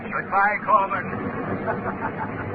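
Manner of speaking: slow drawn-out goodbye into a rising phantom laugh; very slow, eerie goodbye then a high ghostly cackle; sinister farewell laugh. Acted. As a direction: sign off with a haunting laugh. Shadow's farewell and signature laugh.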